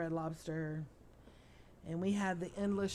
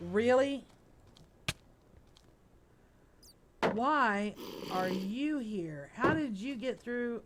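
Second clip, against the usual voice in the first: surprised; 4 semitones higher, 6 dB louder, slower than usual. Real, not acted. Mob appears in his base.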